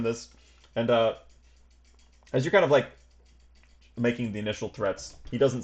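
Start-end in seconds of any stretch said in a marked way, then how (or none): none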